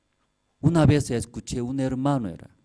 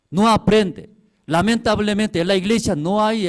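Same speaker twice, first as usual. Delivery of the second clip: high, loud lament from the pulpit; loud lament preaching; 9 semitones higher, 7 dB louder than usual. Acted. Preacher lamenting to the brethren, loud and high. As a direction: cry out the church's failing.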